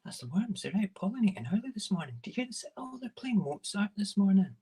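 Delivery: a giddy, high admirer of the flying worms, with whispered bits; high delighted onlooker. Pretend. Imagining people hearing the flying worms, in a high delighted voice. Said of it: "So you can hear them coming and go".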